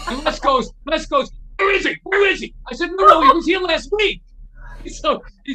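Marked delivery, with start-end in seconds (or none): 1.6-2.5 very high, loud, urgent demand; retold angry demand. Guitar legend hunts the thief, mock outraged. 2.9-4.2 loud, high-pitched correction; hasty protest. Retold or imitated. Guitarist hastily corrects his angry idol, flustered. Hastily calming Les, retold.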